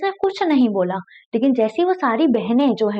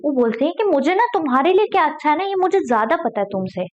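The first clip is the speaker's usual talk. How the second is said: normal talking voice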